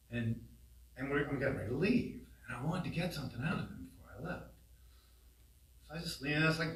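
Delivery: normal talking voice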